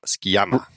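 "Skiama" said with a hard SK, as skee-AH-ma; Spanish-style pronunciation. Shown as a demonstration. Cohost asked how to pronounce the name, says Skiama with a hard K. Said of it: "you'd say Skiam".

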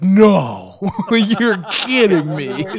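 loud, drawn-out sarcastic surprise; hammed-up incredulity, slowing down; mock disbelief. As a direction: feign being stunned by the obvious. Sarcastic reply about mom being mean.

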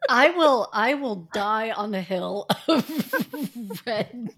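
laughing through a mock vow; giggling while declaring loyalty. Real, not acted.

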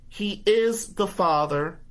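"He is the father" said high and slow, each word hammered; slow it down and hit every word at the father; emphatic accusation. Retold or imitated. Confronting the father with proof.

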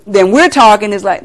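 normal talking voice

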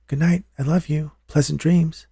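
put-on high, sweet little-boy bedtime voice; young son's funny voice. A narrator's reading. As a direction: lift it high like a child saying goodnight. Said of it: "in that funny voice".

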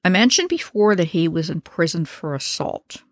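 ordinary delivery

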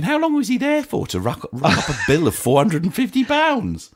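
high-pitched, laughing disbelief; incredulous. Real, not acted. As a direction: question it in amused amazement, voice leaping up. Amazed at huge parking bill.

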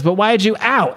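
high, startled ouch mid-question; interrupted yelp. Retold or imitated. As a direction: question jumps high into an acted "ow". Question cut off by sudden stab.

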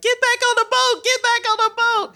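very high, squealed motherly order; panicking mom. Retold or imitated. Mom's voice in her head ordering her back, frantic imitation. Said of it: "hear my mom's voice in my head".

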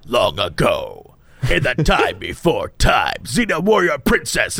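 low, slow trailer voice; deep movie trailer announcer; epic fantasy narrator. Retold or imitated. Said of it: "they'd be like".

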